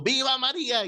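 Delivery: high, hammed-up old man shouting; shrill-pitched mock cry of devotion; retold old man's yell. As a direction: mimic a fanatic old man yelling in his face. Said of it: "estaba el viejito gritando".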